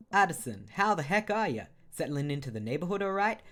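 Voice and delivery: loud, hearty American neighbor; high, booming American-accented hello. Acted. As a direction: greet them big in an American accent. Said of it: "the sort of American accent I do".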